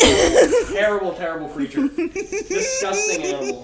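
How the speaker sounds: high, loud, laughing revulsion; laughing disgust. Real, not acted.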